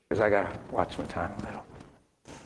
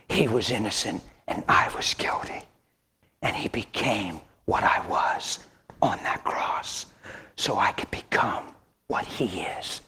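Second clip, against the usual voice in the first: hushed preaching; 2 semitones lower, 4 dB quieter than usual. Acted. Quiet intimate preaching.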